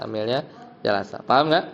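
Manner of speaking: ordinary talk, nothing marked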